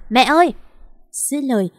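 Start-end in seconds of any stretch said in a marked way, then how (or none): 0.1-0.5 louder, higher acted call; yelling for mother. A narrator's reading. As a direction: cry out to fetch mother from indoors. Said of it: "chị Vàng hét toáng lên gọi bà Xuân". (gọi mẹ toáng lên, cao giọng)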